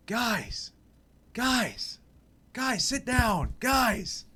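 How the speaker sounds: high-pitched mock scream, drawn out; retold screaming. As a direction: imitate someone screaming at strangers to sit down. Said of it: "just screaming at us".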